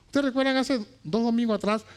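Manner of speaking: ordinary delivery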